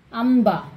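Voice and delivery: Amba with the lips closing on m before b; teacher's model Amba, m clearly sounded; dot as m. Shown as a demonstration. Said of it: "Ma, Im pronunciation".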